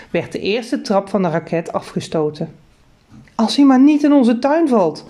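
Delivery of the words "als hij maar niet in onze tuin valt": high, louder worried squeak; mother squeaking from the hallway. Acted. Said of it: "piepte mijn moeder".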